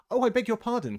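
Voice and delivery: high, loud, rushed apology; startled girl apologising in a hurry; hasty apology. A narrator's reading. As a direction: blurt it out, afraid she hurt him. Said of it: "cried Alice hastily".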